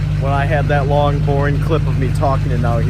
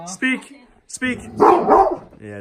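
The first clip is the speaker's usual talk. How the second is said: very high squeaky command; chipmunk-high dog command; helium voice; 12 semitones higher, much slower than usual. Real, not acted. Shoot all the helium and then bark.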